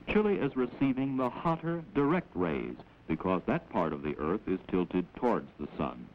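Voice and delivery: usual speaking voice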